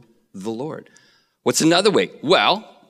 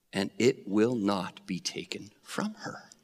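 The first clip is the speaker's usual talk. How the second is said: hushed reading; 5 semitones lower, 8 dB quieter than usual. Acted. Solemn reading of verse.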